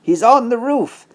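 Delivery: high, worried piggy cry; panicked pig. Acted. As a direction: squeal it out in a panic. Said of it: "asked Boris".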